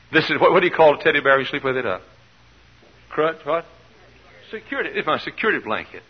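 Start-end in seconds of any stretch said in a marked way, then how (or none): none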